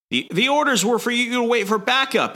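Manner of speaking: hammed-up comic-book rebuke; mock Boba scolding. Boba scolding late Valance, in a high put-on voice.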